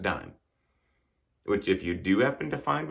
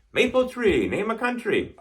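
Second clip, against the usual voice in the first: quiz prompt; 8 semitones higher than usual. Retold or imitated. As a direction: pitch "Maple tree, name a country" up like a quizmaster. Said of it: "people were saying that so much".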